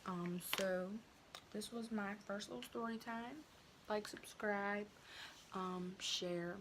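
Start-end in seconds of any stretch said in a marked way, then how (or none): none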